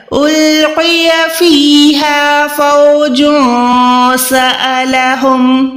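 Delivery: slow drawn-out recitation; Quran recitation. Shown as a demonstration. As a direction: chant it slowly with long vowels. (धीमी तिलावत)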